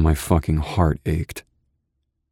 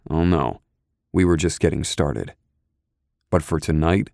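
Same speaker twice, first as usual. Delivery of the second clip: ordinary delivery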